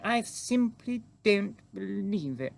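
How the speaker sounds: high, slow, drawn-out regal disbelief; high-voiced queen, taken aback; astonished queen. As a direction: stretch out the words like an incredulous queen.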